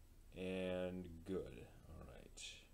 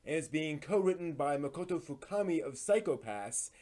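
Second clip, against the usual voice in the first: brisk, upbeat news host read, a bit louder; lively, a little louder anime news presenter voice; 5 semitones higher, 9 dB louder than usual. Acted. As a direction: present the week's anime headlines like a show host. Hosts weekly anime news segment.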